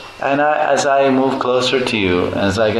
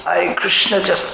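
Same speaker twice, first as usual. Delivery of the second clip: pleading devotee; 9 semitones higher than usual. Acted. A devotee begs Krishna for a little, higher and wheedling. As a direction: beg for just a little, coaxing.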